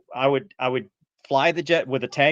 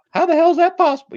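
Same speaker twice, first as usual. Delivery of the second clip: much higher, louder, fast indignant outburst; spun up outrage; 8 dB louder, faster than usual. Retold or imitated. Quoting T-Bear spun up over Wizzos busting pilots, loud outraged. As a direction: fire it off like you can't believe it. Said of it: "T-Bear gets all spun up".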